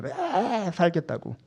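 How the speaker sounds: high, long drawn-out mock wail; mock screaming. Fear clinging to life, hammed-up squeal. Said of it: "엄청 소리를 질러요".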